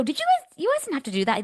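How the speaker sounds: very high, gushing disbelief; grateful surprise. Retold or imitated. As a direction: protest the generous gift, voice jumping up high. Grateful surprise at paid drinks.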